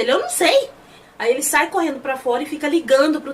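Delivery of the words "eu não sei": high-pitched exasperated retelling; exasperated retort. Retold or imitated. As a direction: act out a frustrated comeback. Acted frantic exchange in story.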